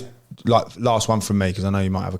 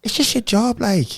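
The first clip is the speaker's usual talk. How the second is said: put-on high-pitched Irish accent, slipping towards Scouse; attempted Cork accent; 12 semitones higher, slower than usual. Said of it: "Go, give us the Cork accent".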